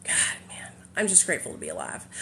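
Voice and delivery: whispered, fast, close to tears; emotional whisper. Real, not acted. Streamer overcome by surviving addiction, whispering. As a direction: breathe it out, overwhelmed with gratitude.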